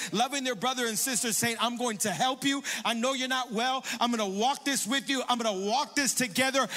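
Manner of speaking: loud, rising preacher's plea; fervent preaching. Acted. Pastor urging the church to love their neighbors, fervent preaching. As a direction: preach it out to the congregation, building.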